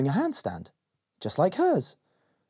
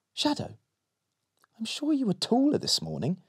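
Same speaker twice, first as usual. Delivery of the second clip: put-on little girl voice; 10 semitones higher than usual. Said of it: "she said".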